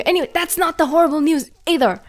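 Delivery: ordinary talk, nothing marked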